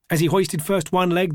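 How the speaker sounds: usual speaking voice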